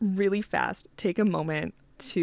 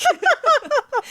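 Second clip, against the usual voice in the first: high burst of laughter; 8 dB louder than usual. Real, not acted.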